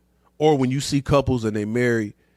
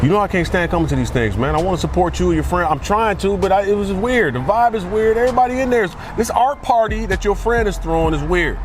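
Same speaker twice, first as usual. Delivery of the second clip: louder and higher, an exasperated rant; skit complaining boyfriend; 4 semitones higher, 6 dB louder than usual. Acted. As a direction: whine and grumble like a fed-up boyfriend.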